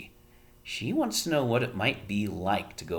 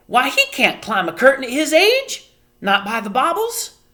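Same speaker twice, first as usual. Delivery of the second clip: high, loud outraged mother; Homily protesting; 9 dB louder than usual. A narrator's reading.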